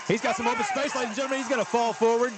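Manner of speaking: excited play-by-play. Real, not acted. Play-by-play of a breakaway run.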